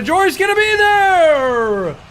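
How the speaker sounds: high, loud, drawn-out call; excited shout. Real, not acted. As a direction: yell the save. Big save call.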